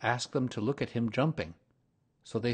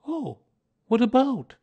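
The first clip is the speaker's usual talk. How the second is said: high, surprised bear voice; a little startled, wondering bear; Pooh voice; 10 dB louder than usual. A narrator's reading. Pooh asks Rabbit what to talk about, high and surprised.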